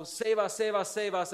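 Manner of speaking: mock yell. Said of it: "and you would yell".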